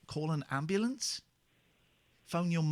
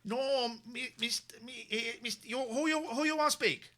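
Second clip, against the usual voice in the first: high-pitched comic impression; 7 semitones higher than usual. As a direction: put on a high, halting voice. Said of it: "You're not doing a bad impression".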